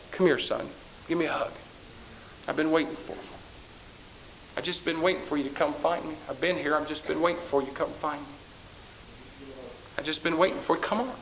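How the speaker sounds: a gently voiced loving Jesus; tender voice of Jesus. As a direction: comfort a returning child. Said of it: "rest upon your shoulder and says".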